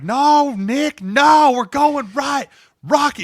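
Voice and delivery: very high, loud scream of the call. Retold or imitated. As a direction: scream him down, refusing to budge. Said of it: "they're just yelling at each other".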